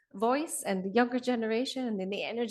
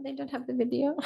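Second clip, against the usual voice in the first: normal talking voice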